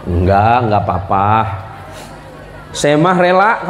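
lightly voiced Sundanese wife saying she's fine; voicing his Sundanese wife. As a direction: play the wife sweetly sighing she doesn't mind. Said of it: "istri saya kan orang Sunda".

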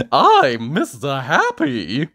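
loud, slow, cheery cartoon voice; Mister Happy. A narrator's reading. Happy visitor introduces himself, loud and cheery. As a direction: announce it brightly, drawing out each word. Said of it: "said Mister Happy".

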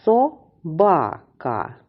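slow "Собака", split into со-ба-ка; syllable by syllable. Shown as a demonstration. Teacher introduces a longer word to pupils, syllable by syllable. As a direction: sound out "Собака" in separate syllables. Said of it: "подивимось більш довге слово".

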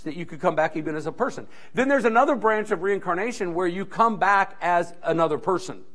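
ordinary talk

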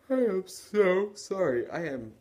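loud, high, slow yawned apology; tired, yawn-swallowed words; talking through a yawn. Real, not acted. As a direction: let a big yawn stretch the apology. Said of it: "way more tired than I probably should be".

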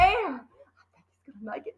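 soft, hushed hope; whispering. A narrator's reading. Matilda whispering to Lavender, soft. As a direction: breathe it to a friend. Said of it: "Matilda whispered".

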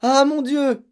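high, loud cry of fright; horrified exclamation. A narrator's reading. Mother throwing herself at her husband, horrified. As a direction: exclaim in panic. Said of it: "s'exclama la mère".

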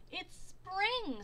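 high, loud delighted; happy Groundhog. A narrator's reading. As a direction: greet the spring gladly. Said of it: "said Groundhog".

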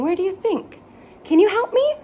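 ordinary delivery

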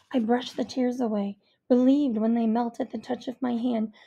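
ordinary delivery, nothing marked

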